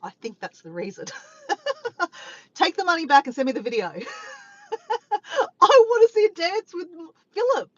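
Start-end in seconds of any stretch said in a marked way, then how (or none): 2.5-3.9 laughing half-shout; hammed-up shout at the tipper; mock demand. 5.6-7.7 loud, high playful plea; giddy loud insistence; excited demand. Acted.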